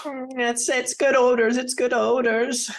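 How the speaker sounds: high, anxious soothing; nervous reassurance. Acted. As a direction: reassure them while scared yourself. Nervously soothing panicking friend.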